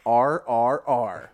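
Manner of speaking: drawn out, each letter spaced wide; slow deliberate repeat. Retold or imitated. Kid repeating the letters to the teacher, slow and deliberate. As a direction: spell it slowly as if to a slow listener. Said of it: "Maybe you misunderstood me. I'll repeat myself".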